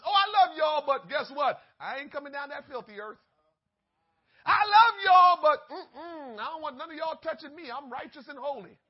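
preacher mocking a God who gives only lip service; mock aloof God. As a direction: play a snooty deity brushing people off, with a laugh at the end. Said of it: "He didn't just give us lip service".

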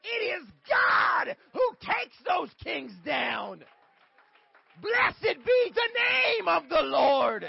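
slow, shouted, high preaching climax; preaching at full force. Acted. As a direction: roar the line to the church. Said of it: "that was the line".

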